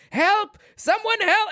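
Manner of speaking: very high, strained mock yell; squeaky retold shout, volume held back; cry for help. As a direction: imitate a frantic call for help. Acting out colonel calling for help.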